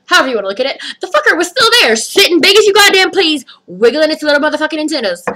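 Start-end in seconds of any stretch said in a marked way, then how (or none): none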